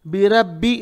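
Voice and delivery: बिरब्बी with a light, flattened ra; thin out the ra in बिरब्बी; wrong thin ra. Shown as a demonstration. Teacher shows a learner's mistake, thin ra. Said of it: "wrong".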